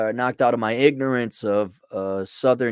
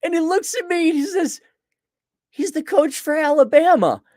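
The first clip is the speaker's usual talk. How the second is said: laughing; 14 semitones higher than usual. Real, not acted. Laughing through the retelling.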